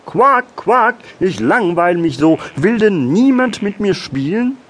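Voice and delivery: high, loud quacking little frog; kleiner Frosch. A narrator's reading. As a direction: call out plaintively as a bored little frog. Frog figure in story narration. (hohe, laute Froschstimme)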